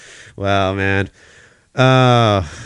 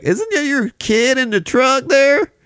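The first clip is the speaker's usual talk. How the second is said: mock Southern drawl, higher and slow; a put-on Southern accent, pitched up and slowed; slower than usual. Onlooker asks about the kid in the truck, mock Southern accent. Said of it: "talking in a Southern accent in Florida".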